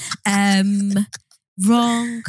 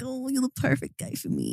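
mock American moan, much higher and softer; 7 semitones higher, 10 dB quieter than usual. Jokingly moaning to her co-host in an American accent. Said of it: "You moan in American?".

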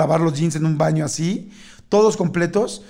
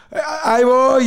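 higher-pitched call to hide who is speaking; disguised voice; 6 semitones higher, slower than usual. Retold or imitated. Man hiding in a bathroom stall answers knockers, disguising his voice. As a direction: call out in a slightly altered voice. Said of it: "yo no quería que se oyera mi voz".

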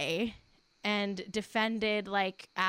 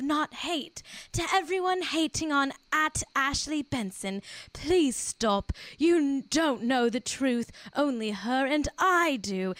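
dramatic posh British read, pitched up; Cara's British accent; 5 semitones higher, 3 dB louder, slower than usual. Acted. Host reading Cara's post to defend her ex, theatrical British accent. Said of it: "No, I'll do it in British".

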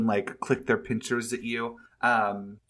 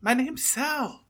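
high character voice; 13 semitones higher than usual. Acted. Player voices Sal, flustered.

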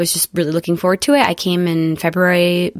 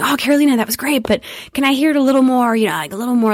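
higher-pitched, lightly imitated studio director; lightly voiced director; 7 semitones higher, faster than usual. Director asks voice actor for more, lightly imitated coaxing. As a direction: coax politely, as a director giving notes. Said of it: "the guy was directing me from the director's room".

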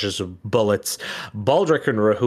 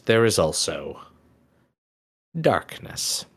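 ominous narration. A narrator's reading. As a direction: drop into dark storyteller mode.

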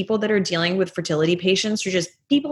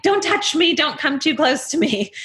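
higher-pitched, hands-off plea; high, fretful keep-away voice; mock panicky warning; 8 semitones higher than usual. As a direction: act out nervously guarding something fragile. Acting out fragile, guarded walking.

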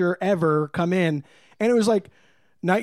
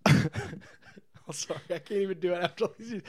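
laughing so hard the words break up; can't get through it for laughing; 4 dB quieter, faster than usual. Real, not acted. Telling the garbage joke, cracking up.

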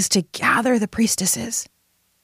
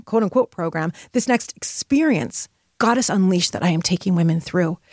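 normal talking voice

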